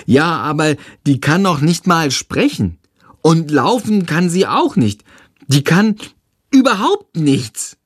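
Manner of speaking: put-on a little kid asking to join in; little brother. Tom voiced.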